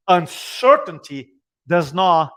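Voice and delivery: slow, loud weight on does not; sermon-style stress; emphatic litany. Acted. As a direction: hammer the word not. Repeated preaching litany.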